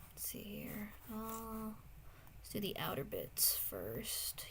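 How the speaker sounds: very soft, absent-minded mumble to yourself; quiet self-talk. Real, not acted. Streamer talking to herself while drawing, quiet self-talk.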